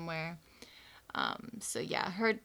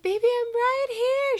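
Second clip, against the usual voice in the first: much higher, louder soothing call; comforting mom; 9 dB louder than usual. Retold or imitated. Mom finds crying daughter in car, high loud soothing call. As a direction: reassure a scared child, high and loud. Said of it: "And I was like".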